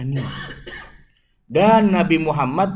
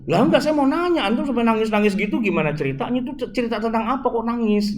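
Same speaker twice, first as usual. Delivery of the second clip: high, insistent questioning; puzzled retold question; 6 semitones higher, faster than usual. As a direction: re-enact pressing the imam, pitched up. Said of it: "gitu kan". (bertanya heran dengan nada tinggi)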